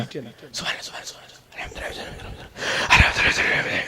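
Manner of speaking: whispered gabble, words blurred together; rushed speed dhikr. Shown as a demonstration. Preacher showing how not to recite, rushed whisper. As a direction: rattle the praises off voicelessly at speed. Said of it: "স্পিরিটে না".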